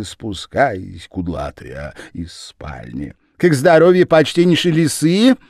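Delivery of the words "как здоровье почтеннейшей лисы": shrieking welcome. A narrator's reading. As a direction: scream with fake joy. Lizzie voiced.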